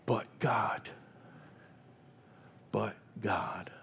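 hushed, breathy "But God" on a falling tune; a quiet, reverent whisper of "But God". Acted. Whispered refrain twice.

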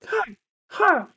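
short, squeaky put-on fighter's yelps; mock exertion yelps. Mocking fighters who fake grunts with each strike, high yelps. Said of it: "bullshit people who are like".

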